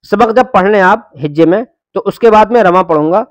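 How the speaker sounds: normal talking voice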